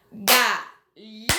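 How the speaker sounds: "Galinha" separada em sílabas, devagar e marcada; silabando. Shown as a demonstration. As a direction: bata palma em cada sílaba de "Galinha". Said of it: "A gente aprende a silabar assim". ("Ga-li-nha" silabado devagar)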